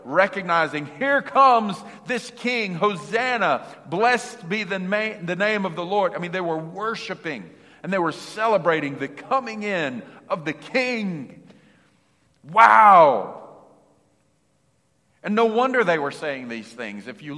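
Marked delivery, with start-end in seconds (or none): 1.0-6.1 higher, louder and slow, exulting; joyful crowd. Retold or imitated. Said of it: "an excitement, an abundance of joy". 12.4-13.4 amazed. Real, not acted. Preacher marvels at the scene.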